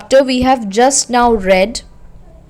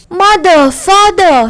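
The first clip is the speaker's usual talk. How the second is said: put-on a child's higher wail, less frantic; 7 semitones higher, 4 dB louder, slower than usual. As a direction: call out for the parents, wailing. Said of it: "he wailed".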